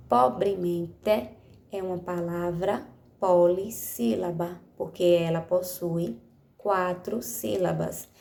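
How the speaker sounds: ordinary talk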